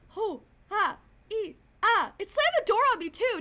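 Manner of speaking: panicked report of the slammed door; frightened outburst. Real, not acted. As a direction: blurt it out, still shaken. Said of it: "That was scary".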